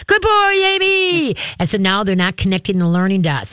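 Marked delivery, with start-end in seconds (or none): 0.0-1.3 high, drawn-out gushing squeal; hammed-up, high-pitched dog praise; over-excited praise. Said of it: "gets them all nutsy in the headsy".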